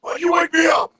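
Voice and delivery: high mock yell; angry drill instructor. Friend imitating the drill instructor yelling. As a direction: bark it like a roused sergeant.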